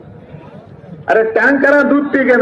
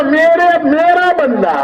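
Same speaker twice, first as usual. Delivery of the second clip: raised, slow and tender, like a loving father; slow fond call of a beloved servant; lovingly; 6 semitones higher, slower than usual. Acted. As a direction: say it with affection, slowly. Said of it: "अरे कितने प्यार से कहता है".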